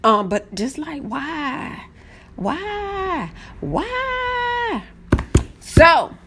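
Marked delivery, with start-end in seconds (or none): 1.0-4.8 soft wail stepping higher each time; plaintive why. Acted. Asking spammers why, soft rising wail. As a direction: repeat it softly, climbing into a high whine. Mock lament about spammers.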